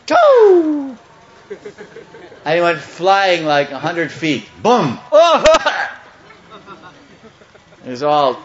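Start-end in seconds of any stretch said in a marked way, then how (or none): 0.1-1.0 long, high, loud held "toe"; vocal whoosh of something hurled away; imitated launch noise. Storyteller showing Krishna throwing the bull, imitated launch. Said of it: "Then he went". 4.6-5.9 loud boom and high-pitched yelp; vocal crash with a squawk after it; imitated crash. Storyteller showing the bull landing, imitated crash.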